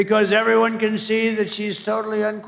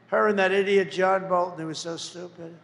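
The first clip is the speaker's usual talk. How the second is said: ordinary delivery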